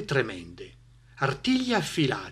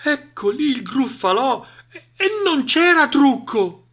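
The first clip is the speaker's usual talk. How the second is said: high, halting realisation, growing louder; alarmed owl; 11 semitones higher, 4 dB louder than usual. Acted. Owl spots the real monster, alarmed. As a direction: falter as the truth dawns.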